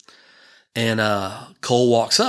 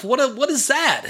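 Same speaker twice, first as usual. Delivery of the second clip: high, slow rising disbelief; astonished; 12 semitones higher than usual. Retold or imitated. Dad shocked by his son's answer, high rising disbelief. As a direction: pitch shoots up in mock shock.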